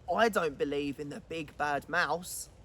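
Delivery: low scornful little monster; defiant gruffalo child. Acted. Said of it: "said the Gruffalo's child".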